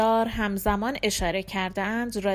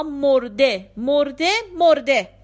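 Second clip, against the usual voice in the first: louder, insistent jab; emphatic taunt; 4 semitones higher, 7 dB louder, slower than usual. A narrator's reading. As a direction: drive each repetition home loudly. Insistent taunt in religious quarrel.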